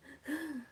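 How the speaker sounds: high, put-on gasp; mock breathless gasp. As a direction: fake a winded gasp. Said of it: "she acts like she was out of breath".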